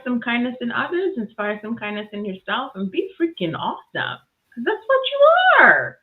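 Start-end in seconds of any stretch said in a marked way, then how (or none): 4.5-6.0 high, loud cheer; exuberant, high-pitched shout; rousing exclamation. Real, not acted. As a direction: belt it out as a hyped sign-off. Rousing sign-off to listeners.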